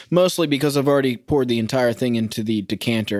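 ordinary talk

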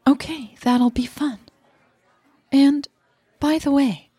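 Leah's voice. Acted. Leah voiced higher.

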